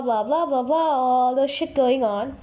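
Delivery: normal talking voice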